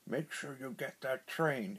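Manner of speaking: menacing villain order. A narrator's reading. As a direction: give the order like a cartoon boss. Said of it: "Doctor Claw told the agent".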